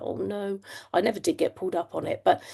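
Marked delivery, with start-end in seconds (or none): 0.0-0.6 quiet mortified. Retold or imitated. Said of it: "I was like".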